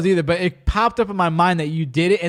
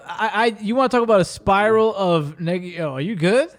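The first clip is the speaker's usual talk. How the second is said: ordinary talk, nothing marked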